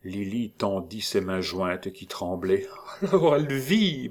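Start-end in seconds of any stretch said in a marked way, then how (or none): 3.1-4.1 high, emotional exclamation; overwhelmed, pitched-up stammer; stammered hope. A narrator's reading. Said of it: "balbutia-t-elle".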